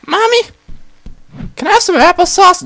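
put-on high, quick child voice; high-pitched pleading kid; little child. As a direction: ask mom like a small kid. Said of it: "mom response".

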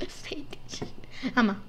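usual speaking voice

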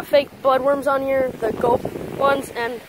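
ordinary delivery

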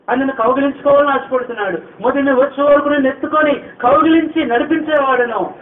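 loud, high, ringing preacher's cry; full-force preaching. Acted. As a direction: proclaim it to the whole hall. Preaching climax.

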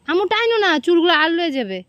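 warning call. Real, not acted. Mother warning child. (সতর্ক করে ডাকা)

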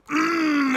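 high, loud drawn-out mmm; yummy hum. Acted. Storyteller voicing Cow eating turnip, loud yummy hum. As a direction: hum it with relish.

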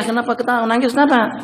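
ordinary talk, nothing marked

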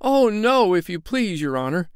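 high, alarmed polite protest; hasty refusal. Acted. Tinker and tailor beg off leading, alarmed. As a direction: wriggle out of going first. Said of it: "said the tinker and the tailor".

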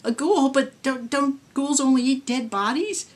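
high, stammering terror; cling to a desperate hope in a frightened voice; terrified. A narrator's reading. Terrified victim facing ghoul.

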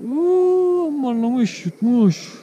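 high yelp of surprise, then dismay; startled cry. Real, not acted. Angler yelps when the fish swallows the hook, surprised.

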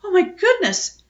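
high, loud startled squeal; squealed alarm. A narrator's reading. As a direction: squeal it out in shock. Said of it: "squealed Phoebe".

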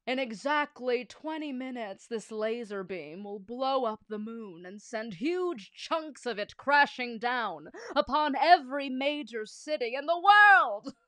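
slow, swelling villain boast; evil villain voice. Acted. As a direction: build to a loud gloating climax.

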